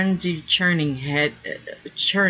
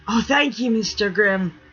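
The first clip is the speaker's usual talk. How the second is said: higher, eager pirate thanks; excited relief; 6 semitones higher, 3 dB louder than usual. Acted. Rigger thanking the mate for sparing him the tub, relieved. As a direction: gush with relief. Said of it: "his friend's excited relief".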